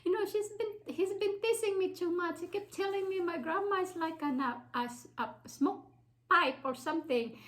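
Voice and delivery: put-on crying child. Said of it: "of course I was crying".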